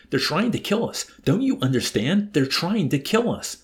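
high, loud desperate plea; frustrated shriek. A narrator's reading. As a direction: cry out in exasperation. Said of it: "Yossarian shrieked in frustration".

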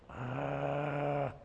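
a low guttural growl like a guarding dog; imitated dog growl. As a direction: growl like a loyal dog protecting its owner. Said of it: "learn how to become like a dog".

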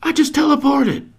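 loud, high victory shout; excited yelling. Retold or imitated. Boy celebrating his first teleport, yelling with high excitement. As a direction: whoop it like a buzzer-beater winner. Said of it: "he screams".

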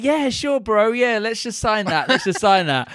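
high, breezy bro voice; casual bro Hitler. Retold or imitated. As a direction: agree like a careless frat bro. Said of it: "Hitler was like".

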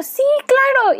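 put-on high, sweet, eager little girl; naive eager yes. Retelling her childhood self, chirpy and eager. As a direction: chirp it like a trusting kid. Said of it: "Y yo".

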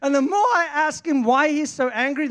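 usual speaking voice

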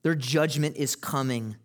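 normal talking voice

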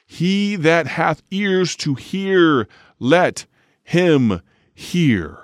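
high, slow, ringing proclamation; loud scriptural call with drawn-out pauses; crying out. Acted. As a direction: cry it out to a crowd, word by word. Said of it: "he cried".

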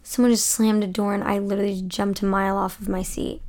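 ordinary delivery

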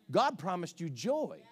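ordinary talk, nothing marked

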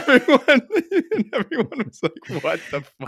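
cracking up while telling the punchline; laughing. Real, not acted. Retelling coach's speech, cracking up.

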